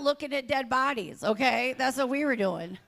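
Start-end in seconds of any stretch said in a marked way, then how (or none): none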